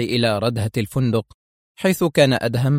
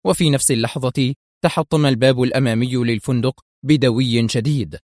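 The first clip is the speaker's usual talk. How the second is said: ordinary delivery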